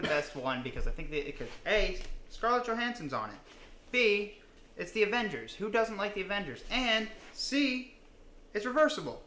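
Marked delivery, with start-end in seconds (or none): 1.7-9.2 higher, bright sales pitch; excited pitch. Real, not acted. Showing off his mask to friends, excited pitch. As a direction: sell the mask with rising excitement.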